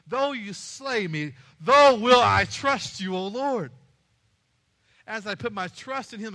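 impassioned declaration of trust to God; fervent preaching. Acted. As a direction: preach it with rising force, stretching key words. Preaches to a climax.

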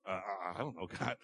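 a cowed man mumbling to God; meek Job. Acted. Job answering meekly.

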